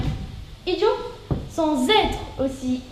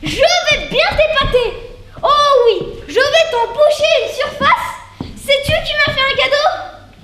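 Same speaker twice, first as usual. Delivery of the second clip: much higher and louder, slowed and laughing through the words; giddy comic character voice; 11 semitones higher, 6 dB louder, slower than usual. Acted. Boasting to her partner about the concierge's gift, high excited voice. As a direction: gush like an overexcited gossip.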